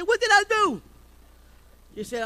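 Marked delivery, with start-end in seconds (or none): 0.0-0.8 high, loud mock panic, falsetto edge; a husband yelping, startled; startled alarm. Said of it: "I said".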